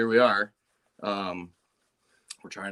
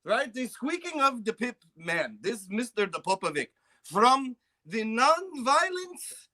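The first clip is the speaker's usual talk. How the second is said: high, hammed-up Serbian accent; comic Serbian accent thanking a friend on a podcast; 7 semitones higher than usual. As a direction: play the grateful Serbian guy in a thick put-on accent. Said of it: "I'm the, like, the Serbian guy".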